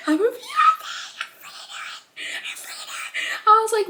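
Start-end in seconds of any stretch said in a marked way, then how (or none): 1.3-3.4 hushed unvoiced whisper; soft whispered repeat. Real, not acted. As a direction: breathe the words out in a whisper.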